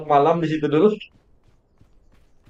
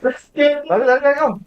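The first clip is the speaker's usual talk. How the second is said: urgent shout; 10 semitones higher than usual. Real, not acted. Urgent in-game danger, telling to run.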